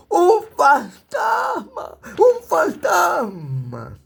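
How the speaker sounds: high, slow, frightened cry; terrified shout. Acted. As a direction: scream it like you've seen a ghost. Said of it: "dieron voces de miedo y gritaron".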